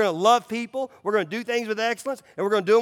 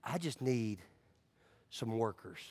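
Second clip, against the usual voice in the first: gentle Jesus voice; 9 semitones lower, 7 dB quieter, slower than usual. Acted. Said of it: "he said".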